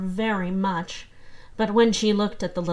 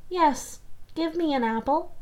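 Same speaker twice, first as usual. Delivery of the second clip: put-on higher child voice asking; little boy asking; 7 semitones higher than usual. Little boy asks his stepmother for an apple, higher voice. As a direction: ask for the apple like a small boy.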